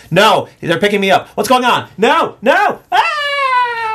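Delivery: building from alarm to high scream; rising panic. Acted. Tank lobster gets picked up to be cooked, mounting panic.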